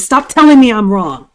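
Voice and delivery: loud mock yell, imitating her; imitated near-yell. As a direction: belt it out like she did on video. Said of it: "She almost yelled and she said".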